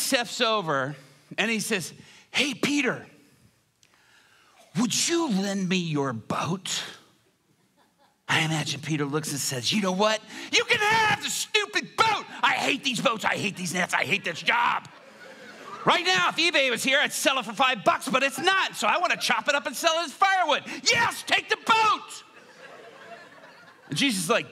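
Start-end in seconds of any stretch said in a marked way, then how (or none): 2.3-6.8 slow, whispery, drawn-out question; gentle request. Acted. Jesus gently asks angry Peter. 9.7-22.2 high-pitched, hammed-up furious rant; exasperated outburst. Peter explodes over the boat.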